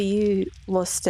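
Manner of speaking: ordinary talk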